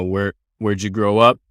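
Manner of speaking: ordinary talk, nothing marked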